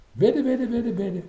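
high, rapid-fire teasing repetition; exasperated sing-song mimicry; mocking echo. A narrator's reading. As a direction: parrot the word back, loud and high. Mocking echo of her bet.

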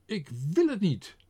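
very high, loud refusal; frightened cry. A narrator's reading. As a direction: back away crying out. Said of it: "deinsde achteruit en riep".

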